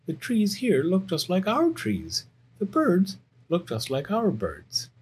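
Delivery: high, puzzled bear cub; wondering Little Bear. Acted. Little Bear looking around the moon, high and wondering. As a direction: play a young bear marvelling at the sights.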